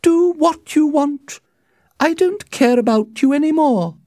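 high, loud dismissal; cold, unyielding mother. A narrator's reading. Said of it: "Lady Capulet answered coldly".

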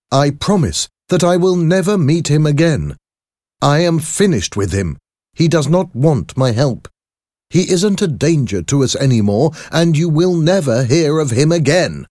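high, loud, insistent promise; agitated doctor, vowing. Acted. Doctor promises his lawyer he is finished with Hyde, emphatic. Voices Jekyll swearing to Utterson.

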